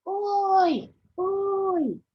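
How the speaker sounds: loud, high, long-held hail; sailor calling out. Acted. Sailor hailing another ship, loud drawn-out call. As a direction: holler it across the water. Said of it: "he's saying, ... calling across to the other ship".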